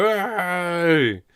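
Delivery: high, loud hey from the crowd; crowd shout. Acted. Awed patrons calling to the birdfolk, shouting. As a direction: call out like an excited patron.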